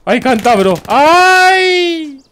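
very high, loud squeal; comic alarm. Acted. Streamer watching Cantabro in danger, high mock squeal. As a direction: shriek his name in mock horror. Player Cantabro about to die.

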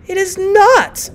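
put-on indignant old woman's drawn-out protest; screamed protest. A narrator's reading. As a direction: drag out each word, climbing to a high cry. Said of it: "screamed the old woman in bed".

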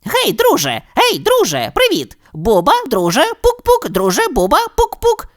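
very high silly walrus babble; babbling walrus. A narrator's reading. Walrus chatters to himself, silly high babble. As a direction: babble to yourself like a loon.

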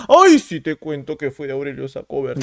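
hammed-up gossipy sigh; milk the line like juicy gossip; dramatic gossip voice. Dramatic repeat, different voice. (voz de chisme exagerada)

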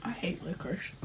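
whisper. Real, not acted. Disgusted after tasting.

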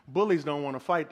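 ordinary talk

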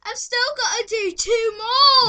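loud strained cry; distressed cry. Real, not acted. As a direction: cry out in shock from the cold. Soaked with ice water, crying out.

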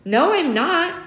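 high, pouty denial; stubborn denial. A narrator's reading. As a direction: deny it like a sulky teen. Teen denies it stubbornly.

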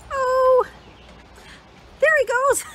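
high, loud burst of delight; excited. Real, not acted. Teacher watching a caterpillar crawl off her hand, excited and high. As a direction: squeal as the bug gets away.

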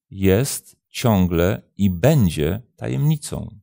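ordinary talk, nothing marked

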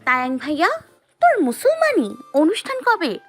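high, loud, excited question; blurted, over-eager question; excited blurting out. A narrator's reading. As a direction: burst out with it, pitch leaping up. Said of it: "ঢুকে বলে উঠলাম".

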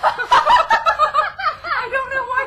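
high, loud, slow laughter; laughing. Real, not acted. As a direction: squeal through helpless laughter. Laughing at teapot transformation.

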